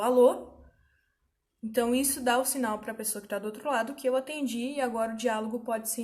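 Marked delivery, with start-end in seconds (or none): 0.0-0.6 loud phone hello; answering the phone. Retold or imitated. Answering a ringing phone with a loud hello. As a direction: call out Alô? into the receiver. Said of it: "quando eu atendo o telefone".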